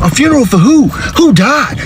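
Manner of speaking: high-pitched, startled dad; alarmed questioning. Acted. Dad questioning daughter's funeral, high-pitched alarm. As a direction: demand it in high disbelief.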